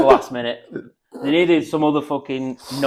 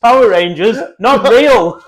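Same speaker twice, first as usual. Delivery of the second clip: higher, louder dismissive dad; mock dismissive dad; 9 semitones higher, 12 dB louder than usual. Dad telling kid Power Rangers aren't real, hammed-up. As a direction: play the dad waving off toys.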